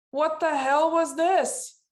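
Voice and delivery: loud, slow, high exasperated cry; mock outraged yell. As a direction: act out scolding the group. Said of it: "And I said".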